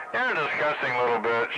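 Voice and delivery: normal talking voice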